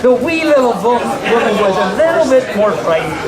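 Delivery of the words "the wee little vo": usual speaking voice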